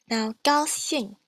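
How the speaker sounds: ordinary delivery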